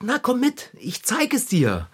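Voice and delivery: lightly voiced Lotte. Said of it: "Lotte gesagt".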